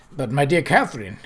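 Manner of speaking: ordinary delivery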